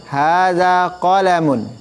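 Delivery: slow model with a short la in qalamun; teacher showing the correct short la in qalamun. Shown as a demonstration. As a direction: keep the la in qalamun short, no lengthening. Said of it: "Yang betul".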